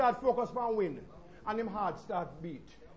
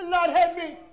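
higher, louder cry for help; desperate plea; 7 semitones higher, 9 dB louder, slower than usual. Acted. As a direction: beg with everything.

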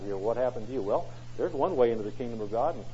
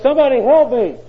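high, very loud frantic cry; panicked plea; 11 semitones higher, 13 dB louder than usual. Retold or imitated. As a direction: beg for help in panic. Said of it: "And he was panicked".

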